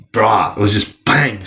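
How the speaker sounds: normal talking voice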